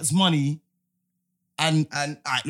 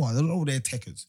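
ordinary talk

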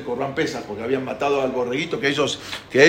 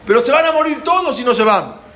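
high, loud warning; urgent shouting; 8 semitones higher, 7 dB louder than usual. Acted. Pharaoh warns that all will die, shouting. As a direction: shout the warning across to them.

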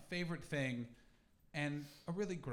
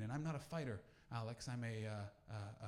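ordinary talk, nothing marked

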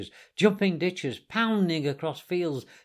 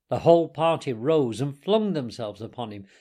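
plain reading voice

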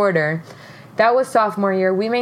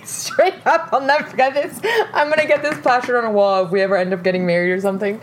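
ordinary delivery

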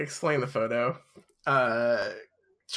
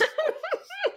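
shrieking high laugh. Real, not acted. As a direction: burst out laughing. Laughing at the line just read.

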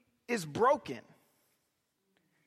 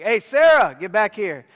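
put-on raised voice calling a child back; calling out louder; 3 semitones lower, 8 dB louder than usual. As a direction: call her back firmly, loud. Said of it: "I'm like".

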